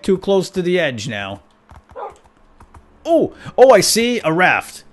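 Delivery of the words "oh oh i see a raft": much higher, louder exclamation; surprised discovery. Real, not acted. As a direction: burst out on spotting it. Spotting the raft.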